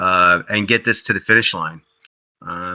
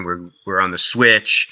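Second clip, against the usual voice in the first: ordinary talk, nothing marked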